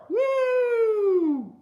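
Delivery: long, loud, very high "woo"; scared whoop. Acted. Storyteller acting the children's fright, loud high whoop. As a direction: let out a piercing sustained whoop. Scare noise after the thunderclap.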